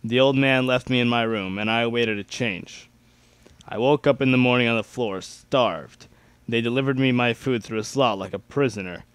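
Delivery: plain reading voice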